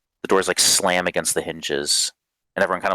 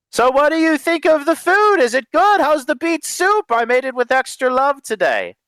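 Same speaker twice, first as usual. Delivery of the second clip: very high, excited character voice; high-pitched, loud, enthusiastic host; eager doting cook; 4 dB louder than usual. Acted. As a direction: fish eagerly for praise of the soup.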